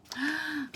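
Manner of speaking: mock gasp. Caps are missing.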